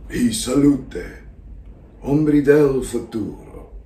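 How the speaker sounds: put-on slow, drawn-out theatrical old-world greeting; in-character stage voice, measured and deliberate; Salieri. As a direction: intone the Italian greeting slowly, pausing between phrases. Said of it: "I'm finding the voice".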